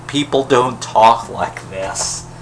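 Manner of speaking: slow, spaced-out, stress on people; slow deliberate repeat. Real, not acted. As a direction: spell it out slowly, word by word. Repeats complaint, second take slow.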